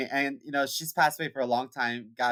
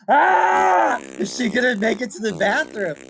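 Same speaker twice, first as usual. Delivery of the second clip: mock-panicked higher shout, laughing; alarmed cry; 9 semitones higher, 7 dB louder than usual. Family watching grandma shuffle to the bathroom, mock-panicked shout. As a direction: squeal it like you can't bear to watch.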